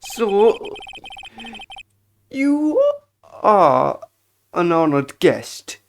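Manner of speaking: slow, deferential hotel servant; obsequious bellboy. Acted. Bellboy offering service to an honored guest, slow obsequious voice. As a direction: draw it out with ceremonial courtesy.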